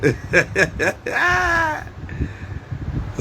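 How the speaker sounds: long high laugh falling away; real laugh. Real, not acted. Vlogger laughing to viewers about last night's party, long high laugh. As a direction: burst out laughing at the memory.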